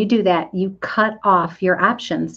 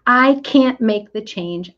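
ordinary talk, nothing marked

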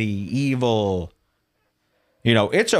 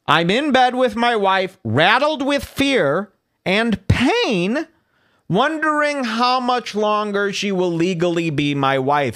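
rereads it higher, stretching rattled with fear and pain out slowly; mock the tweet in a higher, whiny voice; a higher, sneering parody of the post; mocking high reading; 7 semitones higher, slower than usual.

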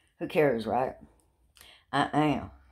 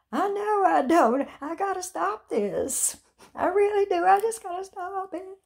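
high, pleading self-scolding; exasperated agreement; 5 dB louder than usual. Retold or imitated. Answering her sister, high and pleading. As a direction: admit it with hammed-up distress. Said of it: "I call my sister".

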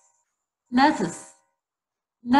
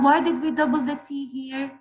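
usual speaking voice

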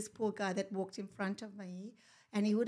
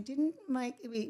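normal talking voice

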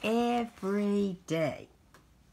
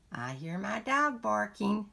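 usual speaking voice

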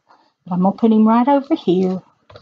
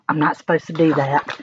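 usual speaking voice

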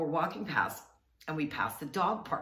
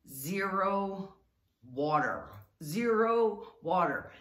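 zero water drawn out slowly, each word stressed; slow explaining voice; 3 semitones higher, much slower than usual. Shown as a demonstration. Explaining zero water slowly to a guest. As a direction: spell it out slowly for a foreigner. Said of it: "I'm trying to explain".

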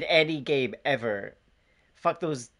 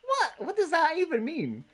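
much higher, incredulous; indignant surprise; 13 semitones higher than usual. Real, not acted. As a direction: squeak out the disbelief. Mock indignant at accent jab.

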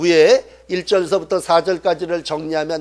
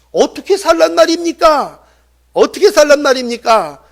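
high, raised cry of protest; acted desperate questioning; exasperated plea; 7 semitones higher, 4 dB louder than usual. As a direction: wail it as a helpless complaint. Acts out people's exasperated plea.

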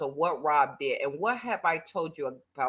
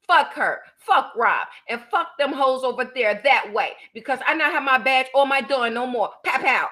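higher and louder, hammed-up fury; mock loud rant; 6 semitones higher, 8 dB louder than usual. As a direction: shout it like her ridiculous rant. Re-enacting her rant mockingly.

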